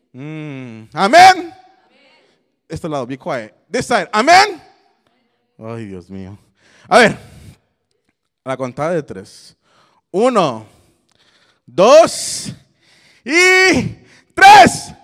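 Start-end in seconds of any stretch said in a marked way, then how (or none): 0.9-1.5 high, very loud amen; booming call-out; rousing shout. Real, not acted. Demands louder answer from crowd. 3.7-4.6 loud, high call to one side; rally this half of the crowd; raised call-out; rousing shout. Real, not acted. Calls on one side to answer. 10.1-14.9 rising shouted cue; shouted countdown. Real, not acted. Counts crowd in for the exercise.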